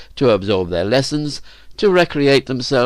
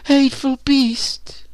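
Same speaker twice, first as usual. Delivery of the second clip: very high, tearful falsetto outcry; theatrically tearful, high and slow; weeping; slower than usual. A narrator's reading. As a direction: wail it in a put-on girl's voice, drawn out. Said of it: "wept Elizabeth".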